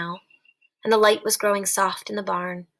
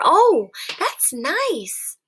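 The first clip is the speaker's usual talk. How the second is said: slow, drawn-out, higher pleasure; pleased; 6 semitones higher, 4 dB louder, much slower than usual. Acted. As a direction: savour every word slowly. Lenny stroking hair with pleasure.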